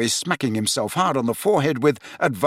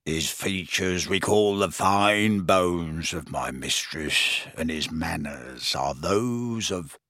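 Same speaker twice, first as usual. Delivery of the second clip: much lower, slow, quieter croak full of reverence; adoring croaking elf; 6 semitones lower, 4 dB quieter, slower than usual. Acted. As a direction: croak it in worshipful awe of his master. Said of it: "croaked Creature".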